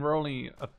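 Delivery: ordinary talk, nothing marked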